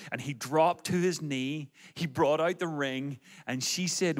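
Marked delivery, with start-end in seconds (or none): none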